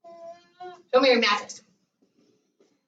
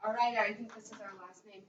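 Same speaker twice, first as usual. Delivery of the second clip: ordinary talk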